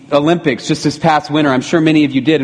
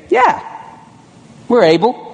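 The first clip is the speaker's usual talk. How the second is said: a sudden high eager "Yeah"; mock eager yes; 7 semitones higher than usual. James and John eagerly telling Jesus they can, mock keen. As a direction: jump in like overeager disciples.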